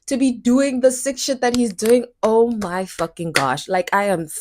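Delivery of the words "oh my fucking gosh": usual speaking voice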